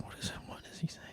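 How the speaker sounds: hushed whisper. Retold or imitated. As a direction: whisper it under your breath. Confused aside at dinner.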